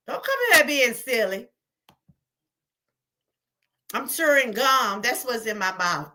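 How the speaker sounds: ordinary talk, nothing marked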